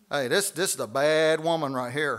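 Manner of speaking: usual speaking voice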